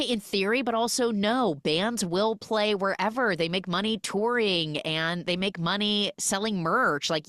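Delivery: ordinary talk, nothing marked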